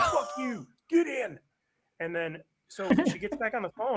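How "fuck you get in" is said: loud, high-pitched angry order; retold angry outburst. Wife cuts off the protest, retold angry outburst. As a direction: imitate someone yelling an order mid-quarrel.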